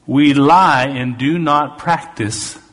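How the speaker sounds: slow, emphatic preacher's reading; scripture reading. Acted. Preacher rereading verse five to his congregation, slow and emphatic. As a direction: proclaim it deliberately, stretching the key words.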